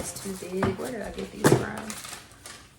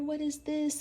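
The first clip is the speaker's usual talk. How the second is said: usual speaking voice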